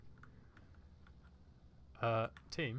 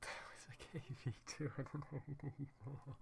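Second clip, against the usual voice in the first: breathless with laughter; 8 dB quieter than usual. Real, not acted. Recalls absurd tanks, breaking up.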